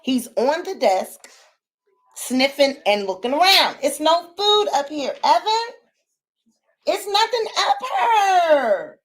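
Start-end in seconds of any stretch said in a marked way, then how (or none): 3.8-9.0 high pet talk. Real, not acted. Scolding the dog on the desk.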